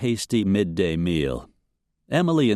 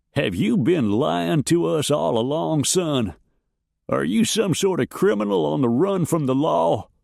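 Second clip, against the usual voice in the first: put-on old farmer, suspicious; 7 semitones higher than usual. A narrator's reading. Peabody questions Luke.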